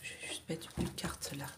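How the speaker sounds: soft unvoiced whisper while laying a card; whispered aside. Real, not acted. (chuchoté en posant une carte)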